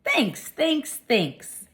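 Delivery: high, squeaky little hamster voice; polite hamster thanks. A narrator's reading. Hamster thanks the librarian in a high squeak. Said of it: "I replied politely".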